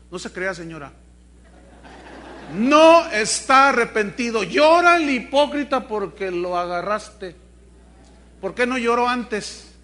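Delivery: normal talking voice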